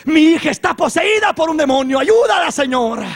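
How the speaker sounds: high, loud cry for help; mother's shouted plea, peaking high; desperate plea. Acted. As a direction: beg urgently at a shout. Acted mother begging Jesus for help.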